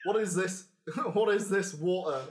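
amused northern barkeep; laughing Yorkshire landlord; northern accent. Acted. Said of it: "I can do northern".

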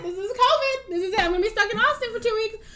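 high, fast hammed-up panic; mock panic. Stuck waiting for results, high hammed-up panic. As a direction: spiral into fake doom.

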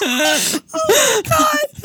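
drawn-out, very high laughing wail; mock sobbing wail. Mother retelling her hallway collapse, mock sobbing wail. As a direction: wail it slowly, half laughing, half crying.

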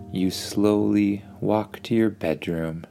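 ordinary delivery, nothing marked

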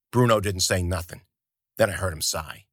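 ordinary delivery

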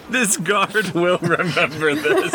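slow, high, through laughter; drawn-out laughing quote. Real, not acted. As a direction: repeat it gleefully while cracking up. Laughing repeat of game master's line.

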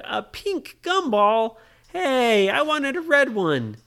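put-on high, loud, put-out child; indignant disappointment. Ryan gets the wrong gumball colour, protesting indignantly.

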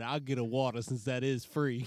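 laughing while quoting him; quoting through laughter. Retold or imitated. Brother retelling dad's joke while laughing hard. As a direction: quote him while cracking up. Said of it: "he said".

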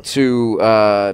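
ordinary talk

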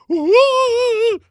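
very high, loud imitated whoop; hammed-up falsetto "whoa", drawn out; Missus Doubtfire. Acting out putting out the flames, imitated cry. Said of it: "Missus Doubtfire is like".

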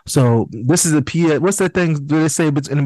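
ordinary talk, nothing marked

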